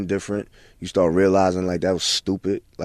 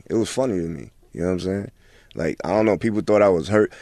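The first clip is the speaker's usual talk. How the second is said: normal talking voice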